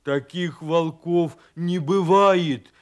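loud, slow disbelieving cry; angry shout. A narrator's reading. Bear cub attacking the wolf, shouting in disbelief. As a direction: shout it out, stretching the last word. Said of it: "крикнул медвежонок".

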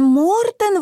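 high-pitched startled cry of the name; exclaiming. A narrator's reading. Martin echoing his sister about the missing boy, high exclamation. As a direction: exclaim it high, falling at the end. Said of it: "воскликнул Мартин".

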